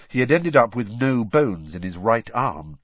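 ordinary delivery, nothing marked

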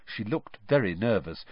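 ordinary delivery, nothing marked

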